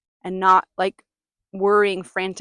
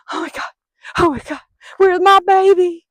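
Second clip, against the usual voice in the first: much higher, much louder hammed-up panic; mock panic; 10 semitones higher, 12 dB louder than usual. Mother acting out losing her dog, in loud mock panic. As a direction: wail like a frantic mother. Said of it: "it is automatic to be like".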